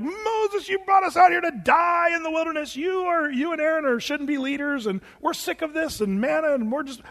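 high, whining mock protest; high-pitched grumbling, acted out; complaining crowd. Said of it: "the people are like".

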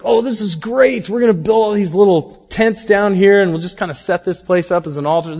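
excited Peter. Retold or imitated. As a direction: gush like a thrilled disciple. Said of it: "He got all excited".

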